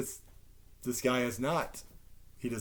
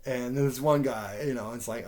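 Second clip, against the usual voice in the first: ordinary talk, nothing marked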